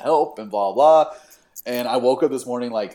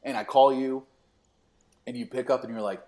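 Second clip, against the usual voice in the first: ordinary talk, nothing marked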